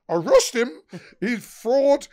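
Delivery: loud, high yell at the cops; retold shout. Pub owner shouting to police to arrest fraud customer, loud and high. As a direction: demand the arrest at full volume.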